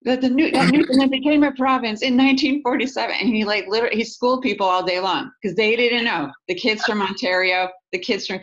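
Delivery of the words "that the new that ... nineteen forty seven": high, indignant outburst; flipping out. Retold or imitated. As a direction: retell him flipping out, pitch jumping up. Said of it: "then he would flip out".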